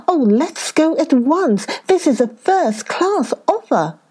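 high, excited donkey's cry of joy; thrilled by the offer, high and bright; elated exclaim. Acted. Donkey answers the hare's invitation, high and elated.

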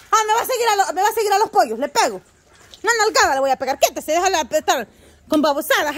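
high, loud scolding threat. Real, not acted. Owner threatening her dog with a smack, loud high scolding.

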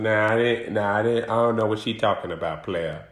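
low mumbling denial as Willie; Willie denying. Retold or imitated. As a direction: play the guilty man who brushes it off low. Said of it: "Willie L".

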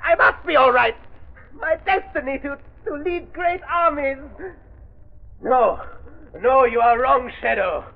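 put-on a man turning young, clinging to bravado; villain's voice turned boyish and frantic; rejuvenated voice. Villain aging backwards, denial.

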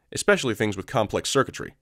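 usual speaking voice

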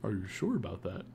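soft, slightly deepened mock doubt. Shown as a demonstration. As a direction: drop into a quiet silly voice. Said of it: "making my voice go down a little".

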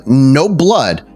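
normal talking voice

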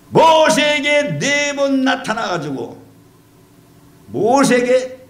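slow, high, ringing proclamation; lifted sermon cry; preaching at full force. Real, not acted. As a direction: proclaim it slowly to the congregation. Preaching crescendo.

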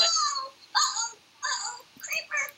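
squeaky panicked cartoon voice; high-pitched voice. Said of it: "'cause your voice is high-pitched".

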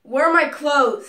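loud, panicked demand; alarmed shout. Acted. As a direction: yell it, frightened and confused. Waking confused captive.

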